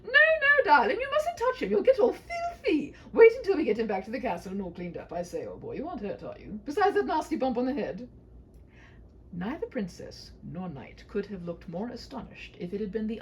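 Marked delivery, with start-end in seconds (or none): 0.0-8.1 very high and loud, fussing with a chuckle; a blustering father fussing over the hero; fussy king. Acted. The king stops his daughter touching the knight, loud fussy bluster.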